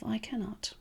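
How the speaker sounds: a hushed, defeated afterthought; resigned whisper. Retold or imitated. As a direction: whisper "But I cannot" like giving up. Naming the limited mode.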